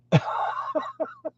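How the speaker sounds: high, thin trailing laugh; real laughter. Real, not acted. Host laughing at his own tease, high laughter. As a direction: let a high giggle run out.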